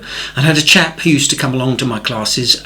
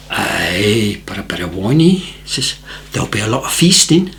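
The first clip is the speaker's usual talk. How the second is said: put-on deadpan Scottish accent, half-whispered; low Scottish aside, warning him; 3 semitones lower than usual. Scotsman warns the newcomer about drinking, hushed Scottish accent. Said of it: "beautiful Scottish accent".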